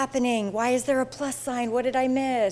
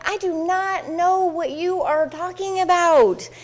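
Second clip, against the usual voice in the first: confused listener; 7 semitones higher, slower than usual. Retold or imitated. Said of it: "And if you're like".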